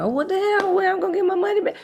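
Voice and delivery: high, loud mock outrage; put-on high-pitched whining about a refund; complaining ticket holder.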